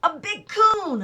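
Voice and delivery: put-on boy proudly telling Mama, excited; excited boast. A narrator's reading. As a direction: savor the brag. Boy boasting his catch.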